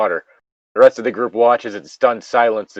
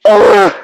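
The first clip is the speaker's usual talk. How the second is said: loud disgusted "ugh"; exasperated groan; 6 semitones higher, 7 dB louder than usual. Real, not acted. Player groaning at the soda choice, exasperated. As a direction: groan out your frustration.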